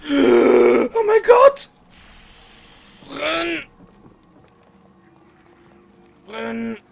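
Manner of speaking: panicked scream, high and loud; being chased. Real, not acted. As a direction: shriek in terror, then keep yelling. Chased, screaming while running.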